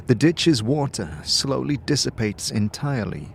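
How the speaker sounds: ordinary delivery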